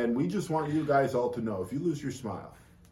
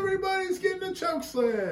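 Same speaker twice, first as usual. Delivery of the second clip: much higher shouted giveaway cry; hammed-up host yell, far higher; Oprah impression. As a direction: shout it like a talk-show host giving away prizes. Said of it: "like Oprah".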